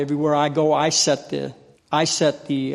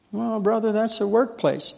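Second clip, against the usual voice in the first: higher pitched mock coworker; coworker protesting; 6 semitones higher than usual. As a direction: play the coworker pushing back. Coworker objects in retold scene.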